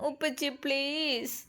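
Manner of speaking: put-on small, soft, slow child's plea; pleading child. A narrator's reading. Daughter begging her silent father in a soft child voice. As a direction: coax quietly like a little girl.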